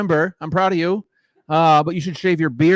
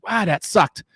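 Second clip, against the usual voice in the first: ordinary delivery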